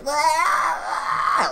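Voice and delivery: high, loud possessed shriek; demonic scream. Retold or imitated. Imitating the woman's freak-out, demonic screaming. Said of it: "like a demon screaming for help during an exorcism".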